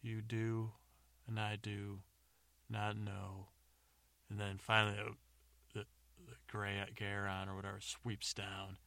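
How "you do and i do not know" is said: drawn-out, chanted mock love poem; terrible love poetry. James keeps going with his love poetry, slow and mock-romantic. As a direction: intone each short phrase, pausing between them. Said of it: "keeps going".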